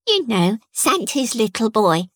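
put-on a proud little boy explaining. A narrator's reading. Toby the child speaks.

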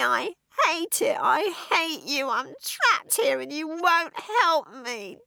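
high, crying, slow plea; self-pitying sobs. A narrator's reading. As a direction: sob and beg for help. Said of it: "subsided into self-pitying sobs".

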